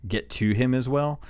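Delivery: usual speaking voice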